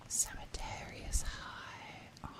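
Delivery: very soft, slow sultry whisper; seductive whisper. Pretend. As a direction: draw it out in a hushed breath. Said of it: "say things in, like, a seductive voice".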